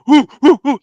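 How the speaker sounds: loud, high whoops; yelling crowd. Retold or imitated. Everybody reacts to the knockdown, yelling. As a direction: whoop like teammates after a big hit. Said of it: "They're all yelling and stuff".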